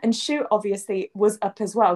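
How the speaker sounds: usual speaking voice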